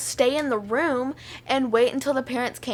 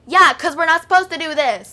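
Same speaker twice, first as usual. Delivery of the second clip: loud, indignant retort, pitched up; yelling; 5 semitones higher, 9 dB louder than usual. Retold or imitated. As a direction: yell it back at the teacher in the retelling. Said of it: "Quit yelling".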